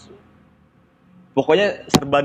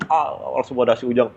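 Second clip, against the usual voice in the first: usual speaking voice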